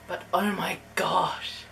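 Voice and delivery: unvoiced, slow gasp of awe; awed whisper. Real, not acted. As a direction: breathe it, amazed.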